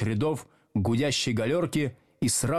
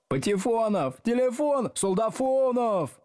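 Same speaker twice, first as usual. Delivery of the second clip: jeering pupils; 12 semitones higher than usual. A narrator's reading. Pupils mock-correct teacher's roll call, jeering. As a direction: call out each name high and teasing. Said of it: "поправляли Слушкина".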